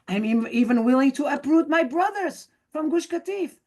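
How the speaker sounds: high, earnest mock offer; a hint of hammed-up sincerity; mock concession. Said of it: "who say".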